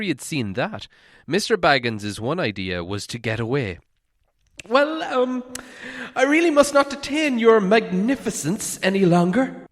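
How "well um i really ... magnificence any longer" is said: high, courteous hobbit voice; bow out politely. Acted. Bilbo taking leave of the dragon, high and polite.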